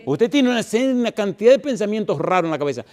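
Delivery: high, loud preaching at full force; fiery preaching. Acted. Preacher urging the congregation, in full fiery preaching. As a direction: preach it hard to the whole church.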